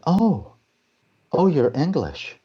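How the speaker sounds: high-pitched, drawn-out mock surprise; surprised realisation. Director finding out the actor is English, taken aback. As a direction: imitate someone taken aback, swooping up high.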